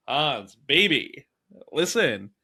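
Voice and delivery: lightly slick it like a car salesman schmoozing a buyer; sleazy car salesman. Retold or imitated. Ellis schmoozing Hans, hinting at a sleazy salesman. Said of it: "sleazy car salesman thing".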